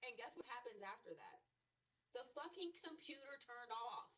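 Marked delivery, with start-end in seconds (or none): 2.1-4.1 slow, drawn out in disbelief; real exasperation, dragged out; exasperated disbelief. Real, not acted. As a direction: spell out each word like you can't believe it. Computer dies mid-reading.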